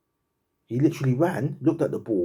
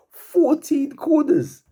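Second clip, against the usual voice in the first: high, slow, stretched out in disbelief; can't believe the number; stunned disbelief; 7 dB louder, much slower than usual. Real, not acted. Fan reacting to the match stats, stunned and incredulous.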